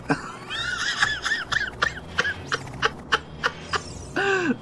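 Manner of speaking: high cackling laugh. Real, not acted. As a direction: burst into a shrieking laugh. Loud laugh at funny scene.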